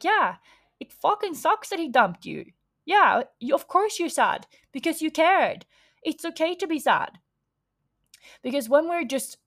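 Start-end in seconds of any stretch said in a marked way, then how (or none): 0.0-7.2 higher, caring friend voice; validating friend. Retold or imitated. A friend validating her sad friend, higher and caring. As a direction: back her up gently and sincerely. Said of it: "just to be like".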